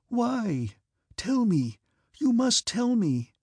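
high, drawn-out desperate plea; almost crying out. A narrator's reading. Princess moving closer to the silent count, almost crying out. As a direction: press for an answer, voice pitched high and imploring. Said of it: "she almost cried".

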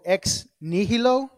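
Latin phrase with Spanish-like flavor; Spanish-like accent. Real, not acted. Preacher introducing a Latin phrase, in a Spanish-like accent. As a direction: give it a Spanish-accented lilt. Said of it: "I said that with an accent".

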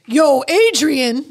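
lazy Philly 'Yo, Adrian'; Rocky impression. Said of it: "Oh, that was not bad".

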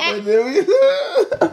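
high, slow laughter through words; laughing. Real, not acted. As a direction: laugh it out, squeaky. Laughing at his own joke.

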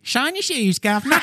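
very high-pitched Cockney street cry; Cockney accent. Pretend. Shoeshine calling to a customer, high Cockney accent. Demonstrating Cockney after it is named.

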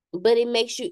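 normal talking voice

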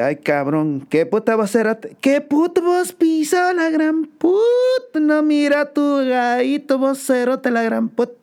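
very high, hammed-up angry scolding; shrill mock Guatemalan scolding; mocking Guatemalan shout. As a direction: screech the insults like an outraged countrywoman. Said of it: "le dice".

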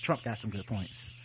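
flat, quiet operating-room voice; deadpan surgeon calmly asking for tools. Pretend.

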